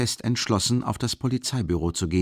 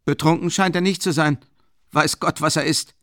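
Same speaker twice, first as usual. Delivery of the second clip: high, louder puzzled grumble; lightly voiced grumbling worker; 9 semitones higher, 5 dB louder than usual. A narrator's reading. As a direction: grumble in doubt. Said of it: "brummte der Arbeiter".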